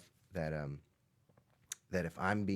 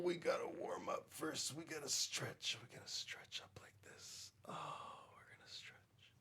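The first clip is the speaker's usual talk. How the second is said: unvoiced whispered instructions; 6 semitones higher, 11 dB quieter than usual. Real, not acted. Teacher whispering stretch steps to kids. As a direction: coach the stretch in a hush. Quiet guidance through stretches.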